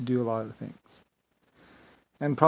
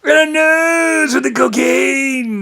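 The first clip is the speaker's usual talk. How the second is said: high, drawn-out loud wail; mock shout; 12 semitones higher, 12 dB louder, much slower than usual. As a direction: belt it out slowly, stretching "nose". Mocking outburst about Rodney's cocaine.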